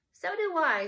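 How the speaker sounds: a high voiced Claudia agreeing; Claudia's voice. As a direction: pipe up as the sister. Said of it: "said Claudia".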